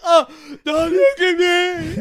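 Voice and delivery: loud, high, drawn-out fake wail; mock crying. Disfigured man hiding his face from girlfriend, mock crying. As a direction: wail it like a hammed-up movie monster. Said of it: "He's crying".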